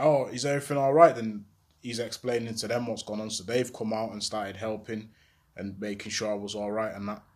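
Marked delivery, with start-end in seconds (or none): 0.0-1.1 a concerned passer-by, higher and louder; concerned woman. Retold or imitated. Passer-by asks a shot young man if he's okay, concerned. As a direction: call out with worried concern. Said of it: "they're like".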